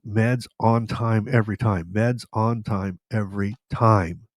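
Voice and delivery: slow, deliberate motto, each word set apart; slow preached slogan. Shown as a demonstration. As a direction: preach the catchphrase slowly. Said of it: "I'm gonna preach it again".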